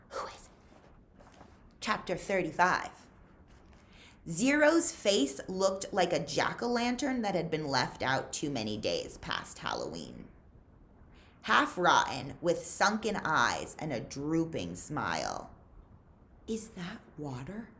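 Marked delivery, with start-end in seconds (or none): 0.1-1.2 whisper. A narrator's reading. Hushed aside to listeners. 16.5-17.7 faint, drained voice asking for water; parched boy near collapse, faint; weak and raspy. A narrator's reading. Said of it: "His voice was weak and raspy".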